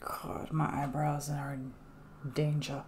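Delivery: breathy whisper to yourself; whispered aside. Real, not acted. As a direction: mutter it under your breath. Muttering aside while mask dries.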